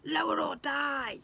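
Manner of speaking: high, loud, slow goblin shriek; squeaky shouted threat. Acted. Goblin prince threatens the bowing family, shrieked. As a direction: drag out the threat shrilly.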